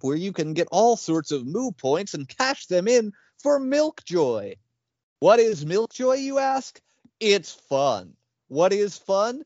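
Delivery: upbeat infomercial pitch; ad read. Pretend. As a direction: sell it like a cheesy TV ad. Improvised milk commercial.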